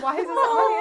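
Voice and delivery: loud, high-pitched mock outrage; hammed-up, high exasperated question; mock exasperation. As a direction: imitate a spouse's incredulous outburst. Retold spat with her husband.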